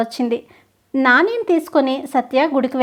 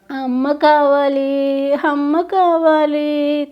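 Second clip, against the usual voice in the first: put-on slow tearful child's plea; lost boy sitting and crying for his mother; much slower than usual. A narrator's reading. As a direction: drag each word out through tears. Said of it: "కూర్చొని ఏడుస్తున్నాడు నాని".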